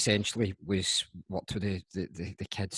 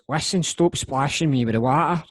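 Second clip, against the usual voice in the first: put-on annoyed wee boy; 6 semitones higher, 7 dB louder than usual. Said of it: "the wee boy goes".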